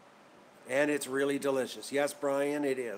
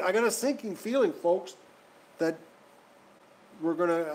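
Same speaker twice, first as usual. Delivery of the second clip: ordinary talk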